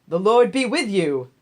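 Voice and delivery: loud call across the field; hearty greeting. Acted. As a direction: greet the workers from afar. Boaz greets the harvesters.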